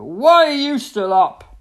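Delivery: loud, slow bellowed demand; bellowing daddy hippo. A narrator's reading. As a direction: holler it out like an outraged hippo dad. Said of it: "bellowed Daddy Hippo".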